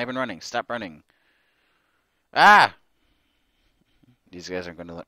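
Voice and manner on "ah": short loud high yelp; startled shriek; sharp scream. Real, not acted. Scream at game.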